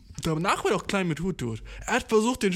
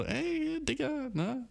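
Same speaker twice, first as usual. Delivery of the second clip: much higher, softer bro hail; bro greeting; 11 semitones higher, 5 dB quieter, much slower than usual. Retold or imitated. Hailing a lookalike as a bro, high and soft. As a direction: greet your lookalike like an old buddy. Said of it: "ist so immer so".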